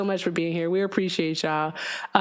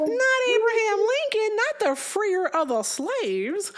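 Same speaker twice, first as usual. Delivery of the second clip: high, drawn-out fake shock; mock disbelief; 12 semitones higher, slower than usual. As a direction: act scandalized in a high voice. Mock disbelief about Lincoln.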